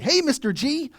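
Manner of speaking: put-on high, bright-eyed kid calling hello; cheerful kid greeting. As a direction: greet the teacher like the happiest kid alive. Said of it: "And he'll go".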